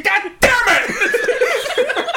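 very high, drawn-out comic scream; frustrated yell. Retold or imitated. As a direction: howl it at the game in mock rage. Acting out frustration at a missed shot.

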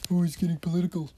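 fast, high mocking whine. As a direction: parody a know-it-all. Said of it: "I'm not one of those people who".

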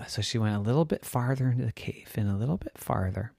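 usual speaking voice

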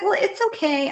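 high-pitched imitation of a dismissive owner; breezy dog owner. Voicing the careless dog owner.

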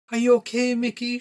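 high-pitched mam's voice; worried mother down the phone; concerned mother. A narrator's reading. Mother checking on crying son.

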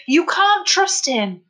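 high, slow, loud plea; older man warns the boy, high and loud; urgent warning. A narrator's reading. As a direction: drag out the warning. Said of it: "Tom's voice rang out in warning".

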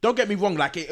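ordinary talk

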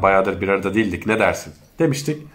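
ordinary talk, nothing marked

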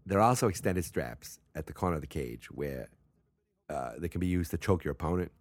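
put-on Boston accent, dropped r's. Shown as a demonstration. Host explains how to win, Boston accent. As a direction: read the rules like a Bostonian. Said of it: "talk with a real Boston accent".

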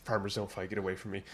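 a soft, brushing-off aside; mock quiet dismissal; dismissive mutter. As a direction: wave her off under your breath. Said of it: "He's like".

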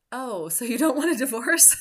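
high, loud burst of relieved surprise; surprised relief. Retold or imitated. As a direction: blurt it out, suddenly relieved. Relieved at husband's apology.

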